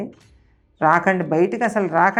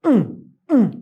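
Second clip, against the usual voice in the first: short hooting hum, imitating an owl; owl-like moan; 4 semitones higher, 4 dB louder than usual. Narrator imitating the owl's moan outside, hooting hum. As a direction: hum it like an owl moaning at night. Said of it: "మూలుగుతున్నట్టు వస్తుంది sound".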